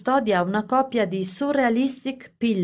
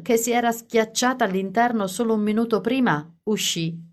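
ordinary delivery, nothing marked

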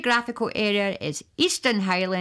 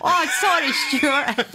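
laughing apology; 4 semitones higher than usual. Real, not acted. Presenter owning up to a name slip on air, laughing.

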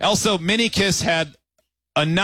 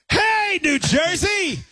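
high, slow drawn-out crowd greeting; mock rock-concert yell at the audience; stage shout; 12 semitones higher, much slower than usual. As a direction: belt it out to the crowd like an emcee. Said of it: "and he goes".

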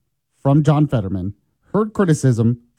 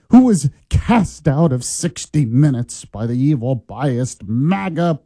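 slow, grandiose sarcastic tribute; mock reverence; 2 semitones higher, slower than usual. Host sarcastically hails a fired anchor before his clip, mock reverence. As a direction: draw it out like an overblown heroic introduction.

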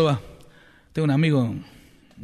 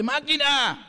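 higher heckler's cry; shouting from the crowd; 8 semitones higher, much slower than usual. Retold or imitated. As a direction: yell it up at the stage. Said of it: "le gritaba".

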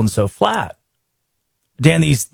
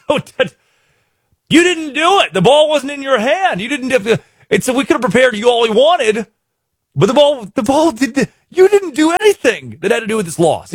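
incredulous; 7 semitones higher than usual. Retold or imitated. Host retells telling a teammate it wasn't his fault, incredulous and high. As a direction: push your voice up in disbelief.

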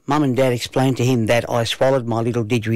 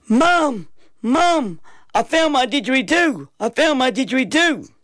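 put-on high-pitched excited boy; excited shouting; 12 semitones higher than usual. A narrator's reading. Boy running to Mum with his news, excited shouting. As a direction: call it out high, bursting with news. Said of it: "excitedly shouting".

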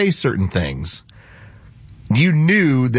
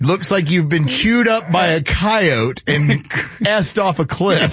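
laughing retelling; 7 semitones higher, slower than usual. Retold or imitated. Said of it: "But he didn't say crapped".

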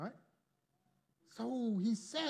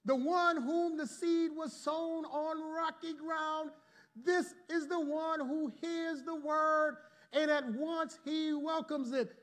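slow, lifted reading of scripture; 5 semitones higher, slower than usual. Acted. Preacher slowly reading the verse to the congregation. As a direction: read the verse slowly, each word weighed. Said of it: "Watch what he says".

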